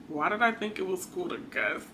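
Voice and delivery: low, very soft musing; quiet, puzzled aside to oneself; hushed self-questioning. Retold or imitated. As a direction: ask yourself under your breath, low.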